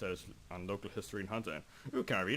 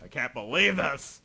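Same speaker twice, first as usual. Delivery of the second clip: frustrated outburst; 5 semitones higher, 9 dB louder than usual. Real, not acted.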